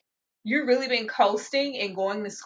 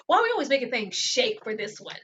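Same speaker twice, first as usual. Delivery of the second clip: usual speaking voice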